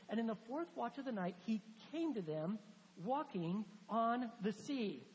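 slowing down on walking on the sea; dramatic retelling. Acted. Preacher dwelling on Jesus coming over the sea, slowing down.

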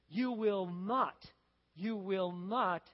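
"you will not" slow and weighty; emphatic warning. Acted. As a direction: draw out "not" and pause after it. Wise men's warning read emphatically.

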